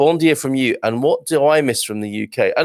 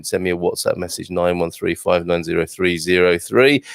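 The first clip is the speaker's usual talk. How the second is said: ordinary talk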